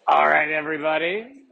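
loud call to gather the room. Real, not acted. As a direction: call everyone back in. Calling room back after exercise.

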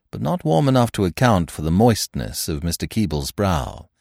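ordinary delivery

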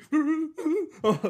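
very high, loud mock wail; imitated crying. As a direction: squeal like a kid bawling. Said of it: "han tudede".

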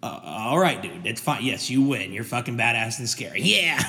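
low, muttered tough-guy gym voice; low mumbling muscle-head bragging to himself; meathead gym bro. Acted. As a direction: play a dumb swaggering bodybuilder under your breath. Skit bro mutters after.

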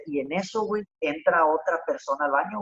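usual speaking voice

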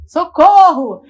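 sudden loud call for help; loud retold scream for help; retold cry for help. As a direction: yell it out like you're lying in the road. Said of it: "eu comecei a gritar".

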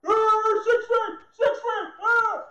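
high, loud panicky shriek; scared masked man. Retold or imitated. Mocking scared shopper shrieking at preacher. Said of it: "Literally, he was like that".

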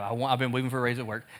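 fast, soft repeat of the plea; rushed retelling. Retold or imitated. Pastor rushing through Thomas's repeated plea, fast and soft. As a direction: hurry through the repeat quietly. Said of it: "He's like".

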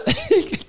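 short high giggle; high laugh. Real, not acted. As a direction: burst into a quick laugh. Laughing at Carla's remark.

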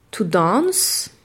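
to dance in British English; "to dance" with a long open a, "dahnce". Shown as a demonstration. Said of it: "le verbe danser se dira". (en anglais britannique, "dance" avec un a long)

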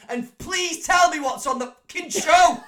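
high, loud, slow laughing plea; begging through laughter; laughing exasperation. Real, not acted. Exasperated after third take.

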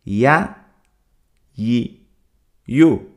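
"ya, yi, yu" each syllable set apart by pauses; syllable drill. Shown as a demonstration. Teacher drilling the letter ya's sounds for listeners, slow and clear. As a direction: drill "ya, yi, yu" slowly, one at a time. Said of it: "Vuelvo a repetir".

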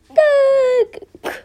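very high, loud, drawn-out squeal; silly voice demo. Shown as a demonstration. Player showing what voice he can do, piercing squeal. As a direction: stretch it into one loud squeal. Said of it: "I can sound like this".

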